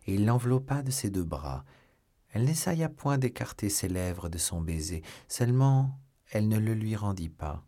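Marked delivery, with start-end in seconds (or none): none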